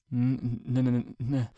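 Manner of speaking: stuttering, choking on the word; can't get the word out for fear; choked stammer. A narrator's reading. Twilight frozen before the king, stammering. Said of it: "I choked".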